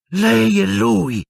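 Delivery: high, drawn-out outrage; shrieked accusation. A narrator's reading. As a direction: stretch the accusation into a cry. Hermione shrieking accusation at Lupin.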